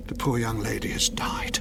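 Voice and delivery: solemn hushed delivery of bad news; hushed whisper. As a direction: break sad news gently. Breaking news of a death.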